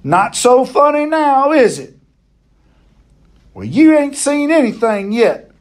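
high, gloating jeer; taunting yell. A narrator's reading. Boy jeering at the monkey he shot, taunting yell. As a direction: crow it up high at a beaten foe. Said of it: "I yelled at him".

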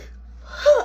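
high, acted intake of air; gasp for breath. Choked kid answering mama, high acted gasp. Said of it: "still trying to catch my breath".

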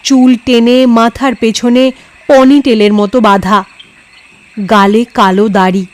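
usual speaking voice

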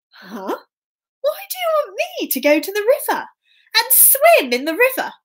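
high, baffled questioning; puzzled miller's son. Acted. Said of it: "the miller's son thought".

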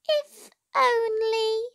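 high, slow, longing little mouse; a small mouse dreaming out loud; wistful mouse voice. A narrator's reading. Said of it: "thought Mouse".

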